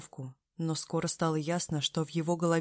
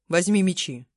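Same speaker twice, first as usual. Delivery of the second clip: command; 6 dB louder than usual. Acted. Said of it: "скомандовал Демир".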